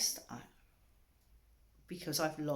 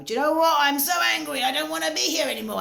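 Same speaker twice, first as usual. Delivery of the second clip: loud, high, furious venting; imitating her own angry self, shouting fast; angry outburst; 9 semitones higher, 10 dB louder, faster than usual. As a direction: blow up in anger, then rush the end. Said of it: "How dare I be angry and, and enough to go".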